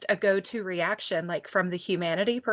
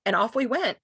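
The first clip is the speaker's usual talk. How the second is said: usual speaking voice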